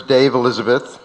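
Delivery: usual speaking voice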